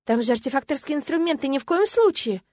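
high, loud, emphatic refusal; alarmed protest. A narrator's reading. Woman protecting her instruments from servants, alarmed. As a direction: climb in pitch to a horrified ban.

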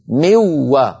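من و as "miw-wa", the n replaced by a doubled w; doubled wāw. Shown as a demonstration. Teacher isolates the hypothetical doubled wāw, full merging. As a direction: press on the w in من و, no nasal hum. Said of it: "يصير النطق بواو مشددة".

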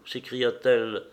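usual speaking voice

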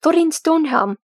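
high, loud acclaiming cry; roared. A narrator's reading. Dwalin hailing the arriving leader, roaring. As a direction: shout the name as a battle cheer. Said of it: "Dwalin roared".

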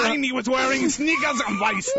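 lightly put-on German accent on request. Cohost asks for German accent, light mock German. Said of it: "Can you do a German accent?".

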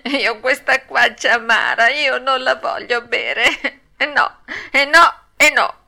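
high, loud tearful puppet tantrum; hammed-up wailing; acted burst of crying. A narrator's reading. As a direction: sob out a stubborn refusal. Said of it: "dando in uno scoppio di pianto".

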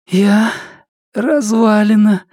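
high, hoarse, exhausted croak. Acted. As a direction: croak it out, worn out. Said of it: "прохрипела она".

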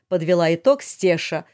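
ordinary delivery

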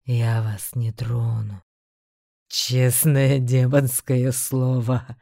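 low, slow demonic rumble; a beast speaking with slow menace. A narrator's reading. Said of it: "расхохотался зверь". (низкий демонический голос)

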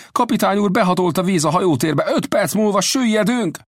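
high-pitched alarmed sailor; urgent, terrified alarm to the captain; frightened report. A narrator's reading. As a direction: report disaster in a panicky high voice. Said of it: "rémülten jelentette".

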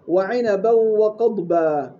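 وعنبا وقضبا stretched out, each letter clear; slow careful model. Shown as a demonstration. Teacher modelling the verse for students, slow and careful. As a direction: read وعنبا وقضبا slowly and deliberately. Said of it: "فتقرأ خطأ الراء".

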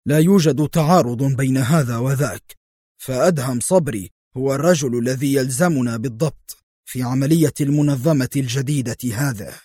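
ordinary delivery

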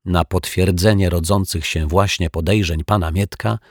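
usual speaking voice

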